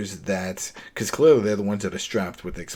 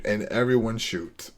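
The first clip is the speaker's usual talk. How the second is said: ordinary delivery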